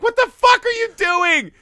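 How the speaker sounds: high, loud, incredulous rant with a laugh; exasperated outburst. Real, not acted. Ranting to his cohost about the ruined ending, high and loud. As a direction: yell at the screen in disbelief. Complaining at the filmmakers.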